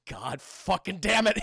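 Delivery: slow, mock-exasperated curse; pissed Sokka. Sokka after the bag hits his face, mock exasperation. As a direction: draw out the annoyed curse. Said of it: "He's pissed".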